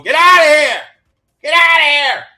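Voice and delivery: high, loud imitated yell; retold shouting. Neighboring campers yelling at a bear, imitated shouting. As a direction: holler it like an alarmed camper chasing off an animal.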